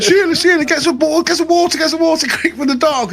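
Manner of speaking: high, yelled panic at his wife; dad shouting to Sheila in a panic about the dog; panicked shout. Retold or imitated. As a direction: cry out for water like the dog is in danger. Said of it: "He's like".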